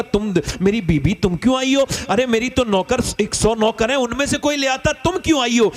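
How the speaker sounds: ordinary delivery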